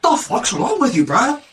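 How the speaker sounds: high, mocking bro rant; jock bully insult.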